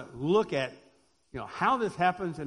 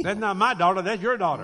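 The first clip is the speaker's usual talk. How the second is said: louder, blaming parent's mock retort; mock parent quarrel; 2 semitones higher, 7 dB louder than usual. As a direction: toss the child back at the spouse, loud. Parents quarrel over a child.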